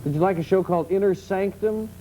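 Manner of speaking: ordinary talk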